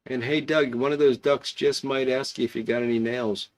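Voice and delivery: ordinary talk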